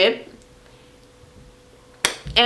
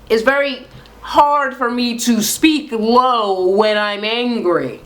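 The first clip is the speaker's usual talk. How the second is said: upset; slower than usual. Real, not acted. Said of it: "I'm very upset".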